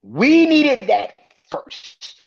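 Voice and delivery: loud, slow and emphatic; emphatic shout. Real, not acted. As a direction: drag it out, shouting the point. Ranting about wrestling booking.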